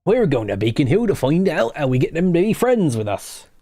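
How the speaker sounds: a touch of a rural West Country accent. Real, not acted. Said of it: "talking with a West Country accent".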